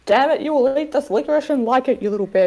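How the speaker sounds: put-on rough New Zealand accent for a villain taunt. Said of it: "I'm trying to do a New Zealand accent".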